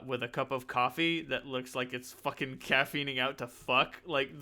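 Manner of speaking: normal talking voice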